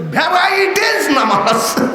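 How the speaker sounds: high, slow, stretched-out call; preacher's punchline. Real, not acted. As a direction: sing out the punchline, drawing it long. Calls out the punchline to crowd.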